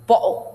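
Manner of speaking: BOTTLE with the t caught in the throat; Cockney-style "bo'le" for BOTTLE; glottal stop. Shown as a demonstration. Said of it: "This is called the glottal stop".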